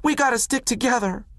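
pleading shout. A narrator's reading. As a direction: fight to keep the family together. Willie protests being separated.

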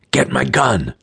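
strained, clenched command; through clenched teeth. A narrator's reading. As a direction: force it out through gritted teeth. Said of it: "then spoke through clenched teeth".

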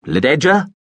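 a loud, high, searching call of her name; sudden call. Acted. Veppers calling out for the hidden girl, loud questioning call. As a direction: call out suddenly into the dark theatre, rising like a question. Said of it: "he called out suddenly".

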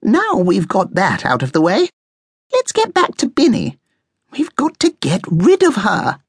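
high, bossy push to move on; impatient high-voiced bossiness. A narrator's reading. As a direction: take charge in a high, impatient voice. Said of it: "listening to the conversation impatiently".

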